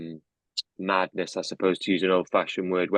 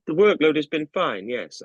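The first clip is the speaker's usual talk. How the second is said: yawning through the words; 8 semitones higher, 3 dB louder than usual. A narrator's reading. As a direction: say it mid-yawn. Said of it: "along with a completely unintentional yawn".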